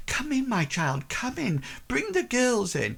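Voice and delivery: a hint of a kindly mother; welcoming mother. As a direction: usher the child in gently. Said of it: "like, says".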